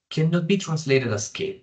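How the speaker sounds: ordinary talk, nothing marked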